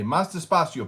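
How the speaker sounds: imitated plea. Asks fast Cuban speakers to slow down.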